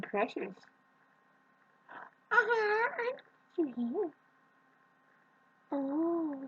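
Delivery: very high, drawn-out cooing; high, tender pet-talk squeal. Real, not acted. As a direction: melt over a tiny precious kitten. Cooing to her kitten.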